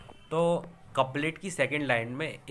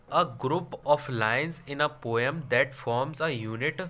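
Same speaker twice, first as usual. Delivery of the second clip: very slow, deliberate reading of a definition; teacher dictating a definition to the class, very slow; slow dictation; much slower than usual. Shown as a demonstration. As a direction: spell it out slowly so students can write. Reads definition slowly, dictation.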